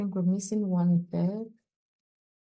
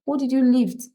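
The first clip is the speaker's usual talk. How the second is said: confused listener; 4 semitones higher than usual. Retold or imitated. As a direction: press them like a baffled friend. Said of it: "The person will be like".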